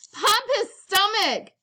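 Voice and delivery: high frantic yell. A narrator's reading. Cousin panicking about the crocodile, yelling high. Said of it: "yelled Alwood".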